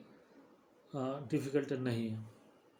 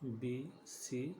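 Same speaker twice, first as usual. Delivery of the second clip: letters drawn out slowly and softly; slow, quiet reading of the alphabet बी, सी; slow letter recital; 6 dB quieter, much slower than usual. Shown as a demonstration. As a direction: spell out बी, सी slowly like reciting to children. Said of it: "ए से लेकर के ए".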